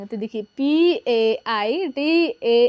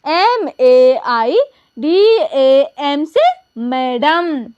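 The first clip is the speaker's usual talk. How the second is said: letters spelled slowly and clearly; teacher spelling for pupils; 4 dB louder, much slower than usual. Shown as a demonstration. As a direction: dictate each letter, slow and firm. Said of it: "तो देखिए".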